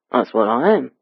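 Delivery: confident Cockney reply; East End dialect. A narrator's reading. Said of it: "speaking the East End dialect with such confidence".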